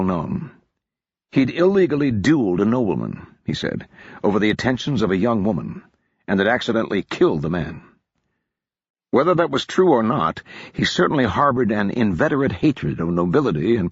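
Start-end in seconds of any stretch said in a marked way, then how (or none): none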